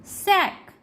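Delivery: सैक with an open ऐ, pitched high. Shown as a demonstration. Teacher modelling A for learners, high सैक with open ऐ. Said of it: "ए के रूप में भी किया जाता है".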